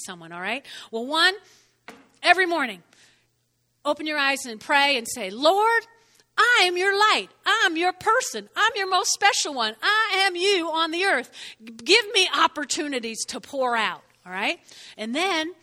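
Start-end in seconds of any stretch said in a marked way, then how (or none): 5.3-14.0 loud, high pleading to the Lord; fervent prayer. Pretend. Believer praying aloud each morning, loud and high. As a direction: pray it out boldly, lifted high.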